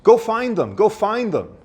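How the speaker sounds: high, pleading urgent voice; urgent amygdala. Retold or imitated. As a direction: urge them to go searching. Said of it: "goes off and says".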